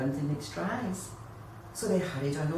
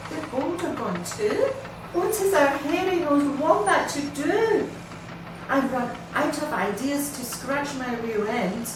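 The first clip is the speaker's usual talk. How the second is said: dismayed wombat; 7 semitones higher, 4 dB louder than usual. Acted. Wombat's despair at loss.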